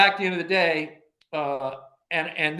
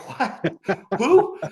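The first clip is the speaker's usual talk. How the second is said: a laughing, high and loud "who"; mock confusion; 5 semitones higher, 7 dB louder than usual. Caller says it's Don, he reacts baffled and high. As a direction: play up the baffled double take. Surprise phone call from stranger.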